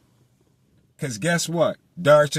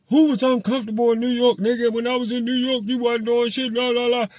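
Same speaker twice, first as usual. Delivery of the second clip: high, fast tough-guy hollering; hollering tough talk; 9 semitones higher than usual. Retold or imitated. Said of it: "hollering all this motherfucking tough gangsta ass shit".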